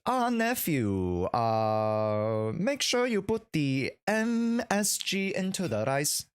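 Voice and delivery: high, slow, drawn-out uncle impression; Uncle Roger. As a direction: stretch the vowels like a comic uncle. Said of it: "What does, what does Uncle Roger say?".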